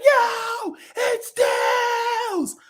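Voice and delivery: high excited shout; crowd cheering. Retold or imitated. Imitating the theater crowd seeing Tails, high excited shout. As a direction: yell it like spotting Tails on screen. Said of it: "everyone was like".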